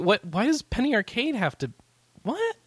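high, loud disbelief; incredulous. Real, not acted. Host baffled that a customer went to a comic strip, loud disbelief.

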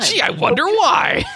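high, loud, drawn-out sarcasm; mock wondering. Host mocking the makers' surprise at the question, mock wondering. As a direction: feign wonder in an exaggerated high voice.

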